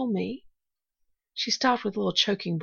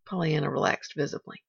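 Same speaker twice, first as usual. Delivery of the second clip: ordinary delivery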